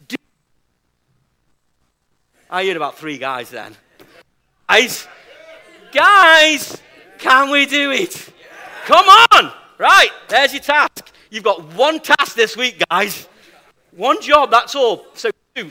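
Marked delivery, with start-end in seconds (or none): 4.7-11.1 loud, rousing call to the room; rallying shout. Real, not acted. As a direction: fire them up, shout it out. Rallying the congregation loudly.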